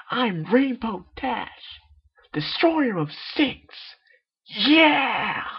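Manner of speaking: high, slow, loud heroic boast ending in a cry; triumphant shout. Acted. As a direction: declare yourself a hero to the sky. Triumphant boast mid-flight.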